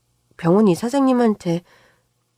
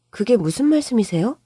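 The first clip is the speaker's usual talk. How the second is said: usual speaking voice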